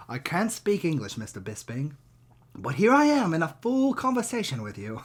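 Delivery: mock British accent, swinging up in pitch; Mario Pinto. Fighter answers Mister Bisping in fluent English, mock British accent. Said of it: "in the Lerone Murphy accent".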